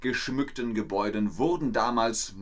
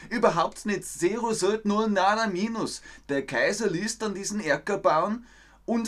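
higher-pitched Viennese dialect; broad Austrian dialect from Vienna; 5 semitones higher than usual. Shown as a demonstration. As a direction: explain it in lively, high-pitched Viennese. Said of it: "das ist der österreichische Dialekt".

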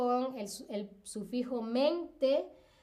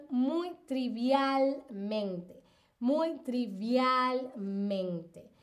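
slow careful reading; much slower than usual. Shown as a demonstration. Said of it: "trivial, en el ejemplo".

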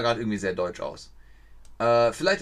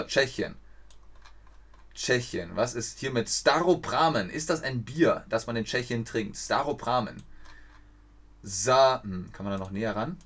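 usual speaking voice

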